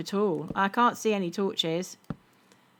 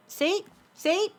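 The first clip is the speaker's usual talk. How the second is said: playful; 11 semitones higher than usual. Acted. Playful high "see" at character.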